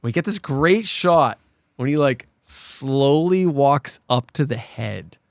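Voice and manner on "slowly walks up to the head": ordinary talk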